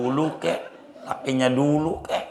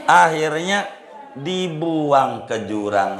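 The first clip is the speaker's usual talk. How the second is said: normal talking voice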